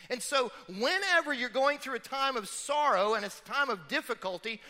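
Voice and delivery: full-force sermon build; fiery preaching. Acted. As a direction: drive it home from the pulpit.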